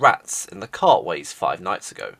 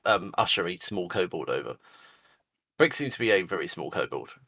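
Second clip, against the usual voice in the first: ordinary talk, nothing marked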